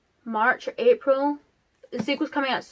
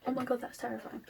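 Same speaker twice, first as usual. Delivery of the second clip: much softer, quoted gasp of dread; hushed horrified reaction; 10 dB quieter than usual. Acted. Reader finishing the footnotes of a horror story, hushed horror. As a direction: drop to a hushed, awed aside.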